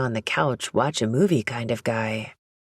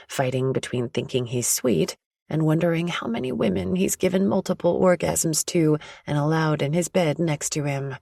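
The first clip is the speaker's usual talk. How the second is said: ordinary delivery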